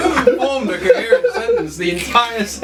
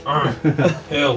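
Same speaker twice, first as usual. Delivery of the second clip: low gruff grunt of a dwarf; a lower, gruff groan; 8 semitones lower than usual. Acted. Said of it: "I just grunt".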